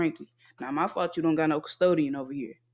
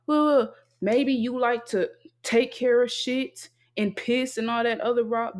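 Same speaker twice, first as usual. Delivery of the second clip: higher, drawn-out mimicking voice; mocking others; 4 semitones higher than usual. Others suggesting maybe you like cleaning, mocking higher imitation. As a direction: mimic them, pitched up and slow. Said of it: "They wanna talk about".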